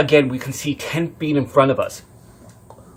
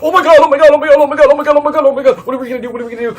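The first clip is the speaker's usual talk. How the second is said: very high, loud rapid-fire shrieking; panicked screaming; 14 semitones higher, 12 dB louder, much faster than usual. Retold or imitated. Passengers seeing a man on the roadside, panicked screaming. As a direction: scream it over and over, easing off slightly at the end. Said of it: "my sister screamed".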